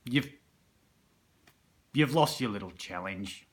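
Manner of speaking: usual speaking voice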